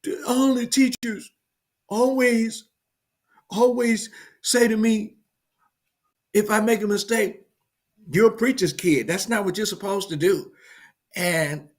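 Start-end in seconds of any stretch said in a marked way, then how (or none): 0.0-10.5 put-on a hurt little girl; young daughter's voice. Daughter tells her father in the car, high and hurt. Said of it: "And she says".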